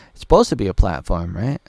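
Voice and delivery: ordinary talk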